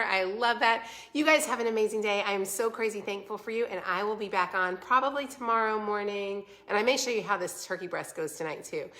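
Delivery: ordinary delivery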